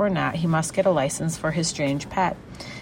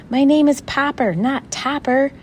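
loud, high-pitched correction; raised voice, insisting on the right name; indignant shout; 9 semitones higher, 6 dB louder than usual. A narrator's reading. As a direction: shout your name to set them straight. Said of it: "shouted Mister Popper".

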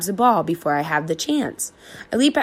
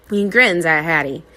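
plain reading voice

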